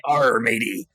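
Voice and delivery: low pirate growl of a catchphrase; pirate accent. Shown as a demonstration. Guest showing the only accent they have, pirate accent. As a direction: throw in a stock pirate voice. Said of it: "and it's pirate".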